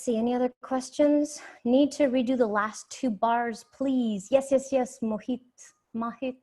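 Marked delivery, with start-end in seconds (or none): none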